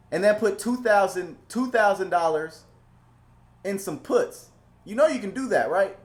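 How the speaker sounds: high, pushed, insistent teaching tone; exasperated lecturing. Real, not acted. Streamer to nervous option holders, exasperated and insistent. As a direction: scold them into seeing the obvious. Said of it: "You should know better".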